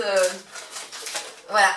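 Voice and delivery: ordinary talk, nothing marked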